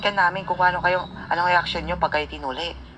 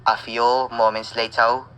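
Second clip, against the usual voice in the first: narrator caption; 6 semitones lower, slower than usual. A narrator's reading.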